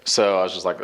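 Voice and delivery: usual speaking voice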